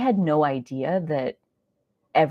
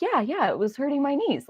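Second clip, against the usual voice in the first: eager agreement; 11 semitones higher than usual. Retold or imitated. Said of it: "Ross is like".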